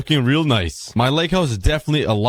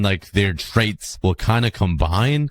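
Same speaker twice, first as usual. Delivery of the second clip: usual speaking voice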